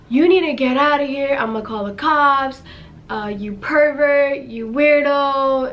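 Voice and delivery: firm, indignant retelling of a warning; retold confrontation. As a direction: draw out the insults in mild mock outrage. Brother confronting the stranger.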